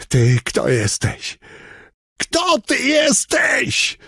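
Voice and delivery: slow, dreadful cry that leaps high; terrible shout. A narrator's reading. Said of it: "krzyknął strasznym głosem".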